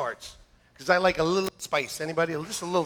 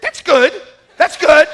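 proud cook; 10 semitones higher, 13 dB louder than usual. Retold or imitated. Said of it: "it's like".

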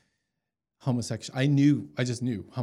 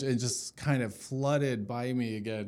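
ordinary talk

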